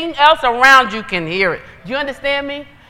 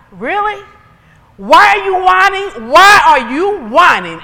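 loud, slow, exasperated demand; exasperated challenge; 6 semitones higher, 7 dB louder than usual. Real, not acted. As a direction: hammer out each word, loud and slow. Irritated preacher challenges whiners.

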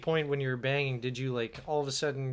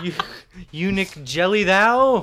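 slow, loud mock Shakespearean; 3 semitones higher, 9 dB louder than usual. Showing off for friends with slow, loud mock Shakespeare. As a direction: declaim it like a stage actor. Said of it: "It's gone all Shakespearean".

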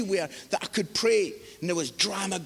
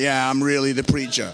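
much lower, deep comic confirmation; mock self-assured; 9 semitones lower, 3 dB louder than usual. Preacher answering the doubtful young guy, mock-cool confidence.

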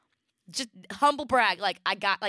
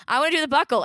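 put-on loud, high-pitched kid's demand; demanding child; 6 semitones higher, 7 dB louder, much faster than usual. Daughter insisting at the car seat, demanding whine. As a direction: imitate an insistent little child.

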